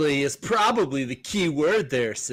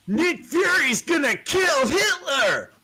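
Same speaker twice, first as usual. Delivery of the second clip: dramatic shout; 11 semitones higher, slower than usual. Acted. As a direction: bellow the cover blurb. Reading cover blurb dramatically.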